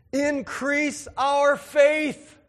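hammed-up cry to the Lord, stretching every word; mock fervent prayer, high and loud; excited plea. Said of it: "you're supposed to get really excited at that".

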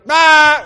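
high, loud frightened scream; mock scream. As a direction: shriek at the ghost. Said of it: "and they see him".

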